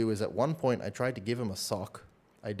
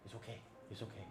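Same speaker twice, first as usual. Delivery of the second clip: hushed, soft shushing to a little lizard; soothing a pet; 14 dB quieter, faster than usual. Real, not acted. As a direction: coo it's okay to calm the pet. Calming his pet lizard.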